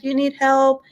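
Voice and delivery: put-on high, sweet little boy voice; eight-year-old son. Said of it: "my poor eight-year-old with his sweetheart".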